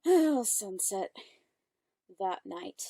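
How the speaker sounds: ordinary delivery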